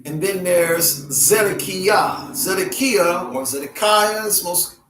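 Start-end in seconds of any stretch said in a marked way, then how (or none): 1.1-2.3 Zedekiah ending in kee-YAH; original-style pronunciation. Shown as a demonstration. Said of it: "commonly called". 2.3-3.3 Zedekiah as zed-eh-KEE-uh; alternate pronunciation. Shown as a demonstration. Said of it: "commonly called". 3.4-4.3 Zedekiah as zed-eh-KYE-uh; common pronunciation. Shown as a demonstration. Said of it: "commonly called".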